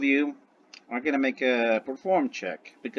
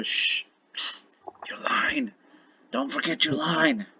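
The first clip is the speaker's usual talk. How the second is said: hissed whispered prompt, pitched up; stage whisper; 13 semitones higher, slower than usual. Acted. Dragon whispers to someone who forgot the line, stage whisper. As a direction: whisper it urgently as a prompter would. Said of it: "the dragon is gonna whisper".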